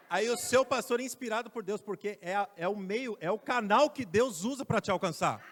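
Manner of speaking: loud, high preaching voice; fiery preaching. Acted. Preacher exhorting the congregation, loud and high. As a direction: preach it out to the church.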